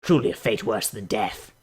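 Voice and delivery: usual speaking voice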